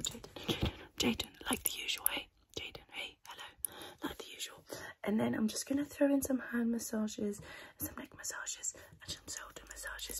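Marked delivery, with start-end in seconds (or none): 0.0-4.6 soft breathy ASMR whisper. Acted. ASMR whisper, calling the client. 7.8-10.2 ASMR whisper. Acted.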